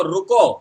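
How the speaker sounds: loud, drawn-out "रुको", then stop; "रुको" as a firm standalone command; pause at the comma. Shown as a demonstration. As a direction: punch "रुको" and halt for the comma. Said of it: "comma लगाएं".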